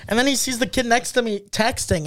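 normal talking voice

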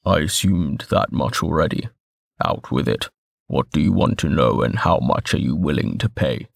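low, gruff and impatient; gruff harrumph. A narrator's reading. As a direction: grumble it out, low and curt. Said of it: "Moret harumphed".

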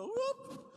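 a high, bright whoop tossed off; quick whoop. Retold or imitated. Pastor mimicking a daughter shrugging off yelling with a high whoop. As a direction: pop the sound up and away.

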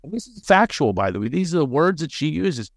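usual speaking voice

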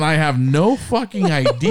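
high, slow, baffled protest; dumbfounded. Retold or imitated. Boyfriend retelling the crowd's fuss over girlfriend, dumbfounded. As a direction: drag it out in bewilderment. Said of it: "I'm completely dumbfounded".

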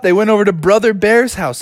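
plain reading voice

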